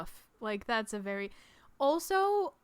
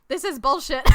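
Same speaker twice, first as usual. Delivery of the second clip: loud, higher, laughing mock outrage; exasperated dad; 5 semitones higher, 9 dB louder than usual. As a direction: imitate a dad blowing up at a show. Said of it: "their dad is just like".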